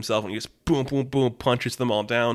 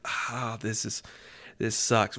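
much softer, hurting, under the breath; quiet pained groan; 10 dB quieter, slower than usual. Retold or imitated. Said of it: "he doesn't say it hurts. He's just like".

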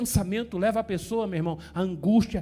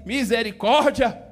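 loud, hammed-up revulsion; disgusted outcry; 2 semitones higher, 6 dB louder than usual. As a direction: cry out as if fleeing an awful stench. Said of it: "você fala assim".

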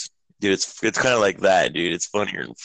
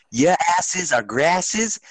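mock coach yell; 9 semitones higher, much slower than usual. Coach yelling at players.

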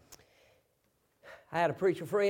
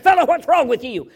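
loud, raised-pitch demand; acted confrontation; 8 semitones higher, 15 dB louder than usual. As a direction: grab him and demand an answer, loudly. Friend grabs and confronts the man.

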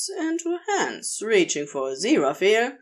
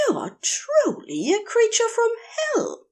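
Aziraphale's voice. A narrator's reading. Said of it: "the Aziraphale replies".

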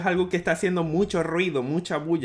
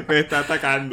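high laugh, then words through it; 5 semitones higher, faster than usual. Real, not acted. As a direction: laugh while saying me está atacando. Laughing at teasing about mother. (riéndose mientras habla)

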